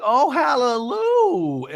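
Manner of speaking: high, drawn-out mock praise; Gina. Recapper mimics a relieved housewife, high stretched mock rejoicing. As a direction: sing out hammed-up relief slowly. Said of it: "And Gina's like".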